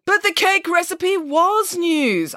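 high, very slow, emphatic rebuttal; affronted pig nanny; arguing. Acted. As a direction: insist with stretched-out indignation. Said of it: "argued Nanny Piggins".

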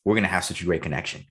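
usual speaking voice

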